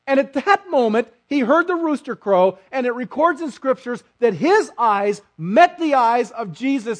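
loud, high fervent preaching; impassioned sermon climb; preaching at full force. Acted. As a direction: drive the moment home to the congregation.

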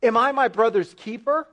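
a smug, defiant comeback; smug retort. Retold or imitated. Cain answering God, smug and defiant. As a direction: toss it back, smug and defiant. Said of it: "this smug response of".

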